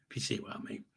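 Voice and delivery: soft whispered afterthought; whispered aside. Real, not acted. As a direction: drop to a whisper. Aside after explaining dinner.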